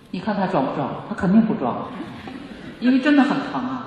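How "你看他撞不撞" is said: ordinary talk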